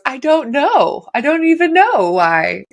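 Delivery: high, slow, teary voice; mock crying friend. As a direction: play the friend weeping, not knowing why. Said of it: "And she goes".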